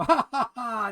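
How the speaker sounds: hammed-up "ha, ha, ha" of onlookers; mocking laughter. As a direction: laugh like the guys teasing a rookie. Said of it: "they'd all laugh and go".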